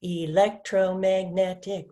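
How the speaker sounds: "Electromagnetic" slowly split, e-lec-tro-mag-net-ic; counting syllables. Shown as a demonstration. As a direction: count six separate beats in "Electromagnetic". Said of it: "six syllables".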